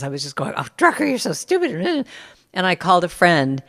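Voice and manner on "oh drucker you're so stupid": self-scolding. Retold or imitated. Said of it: "raking myself over the coals".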